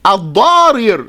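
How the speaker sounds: "Al-Darir" with each r sounded separately; two ra's. Shown as a demonstration. Teacher showing the pattern underneath, "Al-Darir" with two separate ra's. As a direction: sound out both r's in "Al-Darir". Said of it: "with two ra's".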